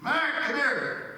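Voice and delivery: high, slow coaxing dog call. Retold or imitated. Owner calling a missing dog, high and drawn out. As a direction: call the dog sweetly from far off.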